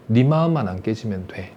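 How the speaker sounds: put-on low, soft and tender to the child; tender reassurance. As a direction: comfort the child gently, quietly. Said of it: "혹시 이런 엄마가 있을까요". (낮고 부드럽게 다독이는 엄마 목소리)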